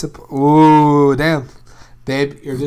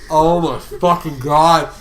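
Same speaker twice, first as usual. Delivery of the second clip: disgusted; 8 dB louder, much slower than usual. Real, not acted. Recalling a gross game scene.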